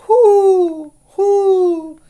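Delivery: loud, very high owl hoots; hooting owl. A narrator's reading. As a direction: hoot like an owl. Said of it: "asked the owl".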